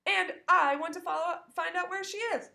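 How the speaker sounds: loud, high determined hamster; small creature declaring a mission; insistent squeak. Acted.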